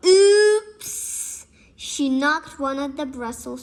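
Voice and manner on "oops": oops. A narrator's reading. Accident in story.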